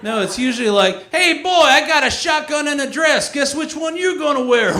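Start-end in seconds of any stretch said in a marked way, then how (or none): 1.1-4.7 loud, high-pitched hillbilly holler; cartoon hillbilly. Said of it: "cartoon hillbilly".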